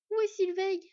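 high, loud, urgent question, lightly winded; out of breath. A narrator's reading. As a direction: manage to ask between breaths. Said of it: "parvint à demander Skad entre deux respirations saccadées".

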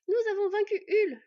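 high, loud, triumphant news; joyful announcement. A narrator's reading. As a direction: announce a victory with glee. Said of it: "l'informa joyeusement Bord". (annonce joyeuse, haute et forte)

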